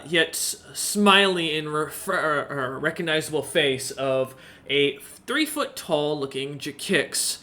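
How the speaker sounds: ordinary talk, nothing marked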